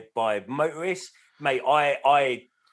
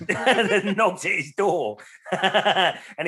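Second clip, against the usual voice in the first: slow, higher, louder words through real laughter; laughing; 7 semitones higher, 5 dB louder, much slower than usual. Real, not acted. Cracking up while finishing the story to friends. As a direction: laugh your way through the line. Laughing at his own punchline.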